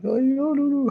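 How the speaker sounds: high squeaky mock ex voice; sing-song teasing no no no. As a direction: squeak it up like a whiny ex. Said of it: "your ex-boyfriend or girlfriend being like".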